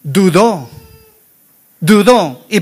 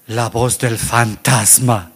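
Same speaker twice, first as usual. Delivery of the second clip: low, slow, softer ghostly voice; spooky ghost voice; 12 semitones lower, 7 dB quieter, slower than usual. Acted. Preacher names the voice Peter heard, spooky and low. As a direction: draw it out low and eerily.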